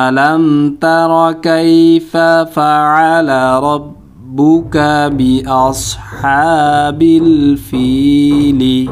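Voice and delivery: very slow, sustained Quran recitation ending on ফিলি; unhurried, evenly chanted verse with stretched vowels; slow continuous recitation. Shown as a demonstration. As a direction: join the whole verse slowly, voicing the final লি. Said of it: "প্রথমে একটু স্লোভাবে উচ্চারণ করব".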